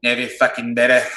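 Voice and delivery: a quick mock New Zealand accent with a laugh. Shown as a demonstration. Coach jokes to Australian host, mock New Zealand accent. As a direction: toss off a cheeky New Zealand twang. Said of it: "that was my New Zealand".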